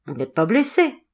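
plain reading voice

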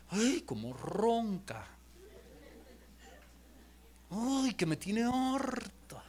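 slow, soft, drawn-out grumble; fed-up wife. Acted. Wife complains about her snoring husband, fed up. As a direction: play a wife groaning softly, drawing out each word. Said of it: "La esposa nota que".

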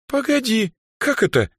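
high, confused double-take; baffled girl, pitch jumping up; bewildered. A narrator's reading. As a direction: ask as if lost for an explanation. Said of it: "растерянно произнесла Даяна".

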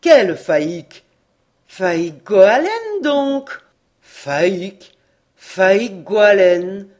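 loud, slowed insistence on the name; a suspicious sailor demanding which Faïque, loudly; defensive demand. A narrator's reading. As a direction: press the name hard. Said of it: "intrigué et en défense".

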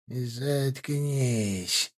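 rasped like a demonic crone; old crone rasp. Acted. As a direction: snarl it in an old woman's rasp. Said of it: "прохрипел совсем не детский".

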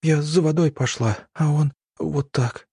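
sobbing. A narrator's reading. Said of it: "всхлипывала Евгения".

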